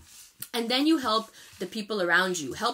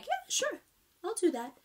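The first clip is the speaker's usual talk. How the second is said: high, soft, offhand consent; airy agreement; 10 semitones higher, 6 dB quieter than usual. Acted. Scorpio agreeing to help a former critic, airy and soft. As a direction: lift it high and light, conceding softly.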